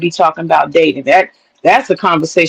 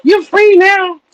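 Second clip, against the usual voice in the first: celebrating divorcee; 12 semitones higher, much slower than usual. Retold or imitated. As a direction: squeal it like a friend high-fiving at a divorce party.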